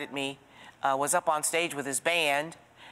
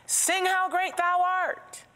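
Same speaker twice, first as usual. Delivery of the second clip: much higher, slow called-out request; shouted request; slower than usual. Retold or imitated. Bar patron shouts a song request, high and slow. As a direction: call it across the bar. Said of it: "somebody shouted".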